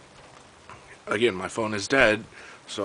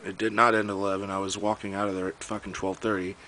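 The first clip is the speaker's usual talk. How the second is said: ordinary talk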